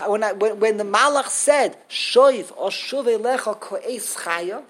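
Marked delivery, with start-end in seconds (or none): none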